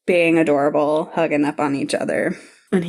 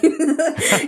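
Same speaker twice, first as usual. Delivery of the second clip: a sudden high, delighted giggle; delighted laugh; 9 semitones higher than usual. Real, not acted. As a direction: burst into a happy laugh. Melting at forehead touches.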